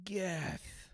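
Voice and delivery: breathy ghost whisper of a name. Acted. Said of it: "I said it with a breathy voice".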